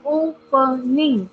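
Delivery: Opening with two pauses inside; syllable by syllable. Shown as a demonstration. Teacher showing students three syllables, opening split by syllable. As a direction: say opening one syllable at a time. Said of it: "two times you have to give a break".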